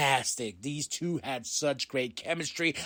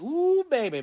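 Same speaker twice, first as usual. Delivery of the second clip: very high, loud squeal of joy; excited ooh; 14 semitones higher, 7 dB louder than usual. Real, not acted. A fan hypes himself up over the new music, squealing high. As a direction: squeal with delight.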